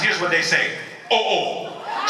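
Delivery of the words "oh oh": a startled "oh, oh", shown to a crowd; Haitian surprise. Shown as a demonstration. Speaker showing the audience how Haitians react, surprised. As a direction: demonstrate a surprised Haitian exclamation. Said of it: "when Haitian people are surprised".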